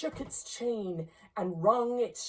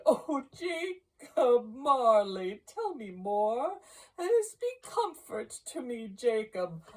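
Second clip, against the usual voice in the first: imploring; 7 semitones higher, 4 dB louder than usual. A narrator's reading. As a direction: beg for comfort, voice lifted high. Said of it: "he said imploringly".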